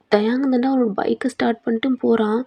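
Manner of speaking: ordinary delivery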